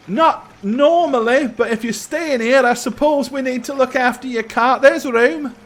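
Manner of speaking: put-on high-pitched boy's voice; young stable boy. Stable boy asking guests about their cart, high boyish voice. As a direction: pitch it well up like an eager-to-serve lad.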